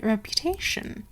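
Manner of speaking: ordinary delivery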